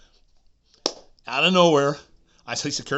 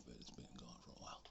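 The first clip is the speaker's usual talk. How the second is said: fast hushed aside; whisper; 10 semitones lower, 23 dB quieter, faster than usual. Retold or imitated. He admits it to the doctor, a quick whisper. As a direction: rush it out in a whisper.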